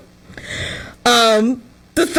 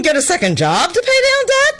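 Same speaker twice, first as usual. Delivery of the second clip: "get a second job to pay down debt?" much higher, rising in mock disbelief; incredulous; 9 semitones higher than usual. Quoting the advice back at Gen Z, high incredulous rise. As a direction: repeat the advice as if unheard-of. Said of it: "What do you mean".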